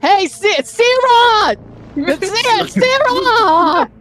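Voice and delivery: high, drawn-out call down a corridor; yelling. Acted. Effie calls round the corner for Syrah, yelling. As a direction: holler the name at full volume. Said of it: "and yells".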